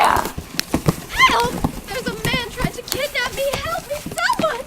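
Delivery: high panicked screams for help; terrified cries for help. Acted. The grabbed mother screams to passers-by, high and panicked. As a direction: cry out desperately for rescue.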